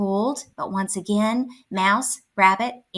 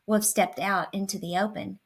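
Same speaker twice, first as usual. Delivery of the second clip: plain reading voice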